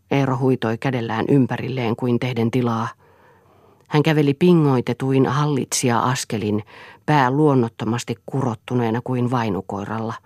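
plain reading voice

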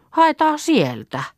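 high, drawn-out call. Acted. Girl to the others about fetching fire, calling out.

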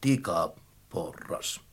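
very low, slow, soft voice; friendly ladder. A narrator's reading. Ladder corrects its name to children, kindly. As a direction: introduce yourself kindly, deep and slow. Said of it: "tikkaat sanoivat ystävällisesti".

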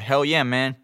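light mock Texan drawl; playful Texan impression in English; Texan accent. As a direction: imitate a laid-back Texan accent. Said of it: "ketä on Texasista, puhuu just silleen".

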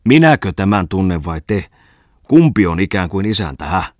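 angry. A narrator's reading. Bungman flares up at Könölin over the engine, angry outburst. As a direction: demand to know who is master here. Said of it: "suuttui Bungman".

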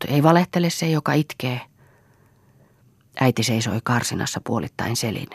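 ordinary delivery

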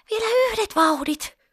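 put-on very high little-girl cry; child's pleading exclamation. A narrator's reading. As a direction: beg for one last push in a high voice. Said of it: "Pippa huudahti".

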